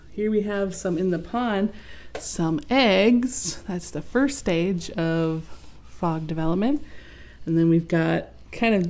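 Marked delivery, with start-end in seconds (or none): none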